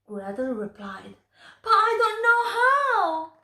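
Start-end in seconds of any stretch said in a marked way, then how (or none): 1.6-3.3 higher and louder anxious plea; frightened protest. Acted. Gretel telling the witch she can't light the oven. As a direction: protest it like a scared little girl.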